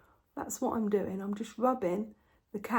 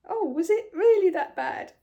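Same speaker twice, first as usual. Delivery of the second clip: higher, louder mock disbelief; husband voice; 8 semitones higher, 7 dB louder than usual. Mimicking her husband's disbelief, higher and louder. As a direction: ham up his doubting question. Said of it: "So he went".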